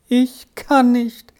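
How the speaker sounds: put-on high, slow child's plaint; acted weeping, drawn out and high; tearful cry. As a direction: plead helplessly as a tearful boy. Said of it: "rief Arthur weinend aus".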